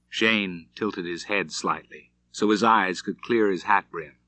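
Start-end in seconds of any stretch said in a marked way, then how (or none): none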